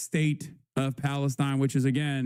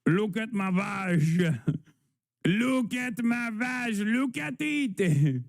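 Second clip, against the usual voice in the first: high, slow mock French accent; slow, hammy French lady voice; deliberately bad French accent; 6 semitones higher, slower than usual. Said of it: "Somehow your accents get worse with time".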